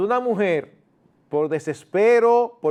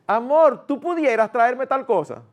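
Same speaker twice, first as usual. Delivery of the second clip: sweet polite request; 6 semitones higher than usual. Shown as a demonstration. A wife asks her husband respectfully, sweet gentle request. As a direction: ask him kindly, like a sweetheart. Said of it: "¿No se oye mejor?".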